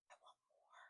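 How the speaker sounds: soft secret whisper. Acted. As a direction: whisper it like a confession. Hushed repeat of her wish.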